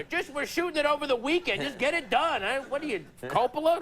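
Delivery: high, impatient producer voice; movie producer. Acted. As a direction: pressure the director like a producer. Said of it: "it's like some producer".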